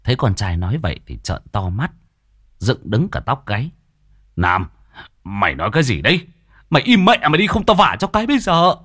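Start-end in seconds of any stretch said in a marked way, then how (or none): 4.3-8.8 stern question bursting into a high yell; father scolding in panic; alarmed angry shout. A narrator's reading. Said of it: "tiếng quát thất thanh của Nôm".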